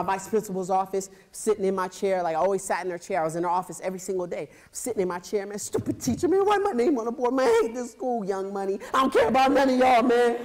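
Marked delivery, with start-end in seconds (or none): 5.6-10.4 put-on high, loud sulky kid rant; angry rant. Kid ranting alone in the vice principal's office, angry. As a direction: grumble it like a furious eight-year-old.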